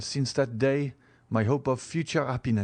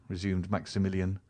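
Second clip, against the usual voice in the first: ordinary delivery, nothing marked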